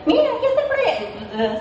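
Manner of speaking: barge in yelling it over a laugh; mock yell. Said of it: "Si eres amarillón y llegas gritando".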